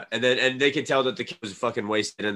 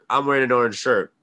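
usual speaking voice

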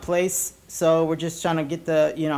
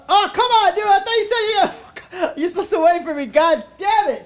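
giggly protest; 12 semitones higher, 3 dB louder than usual. Real, not acted. Laughing protest at cohost.

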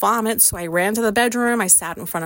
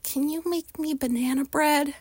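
slightly high, softened asking voice; lightly imitated sweet little request; imitated innocent request; 5 semitones higher, 4 dB quieter than usual. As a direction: mimic a softly put, hopeful question. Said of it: "she said it just like that".

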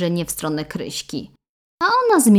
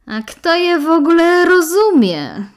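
loud; 12 semitones higher, 10 dB louder than usual. Acted. Friend's loud quip.

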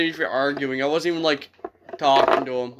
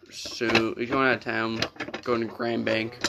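usual speaking voice

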